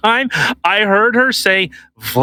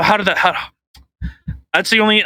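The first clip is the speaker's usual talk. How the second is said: normal talking voice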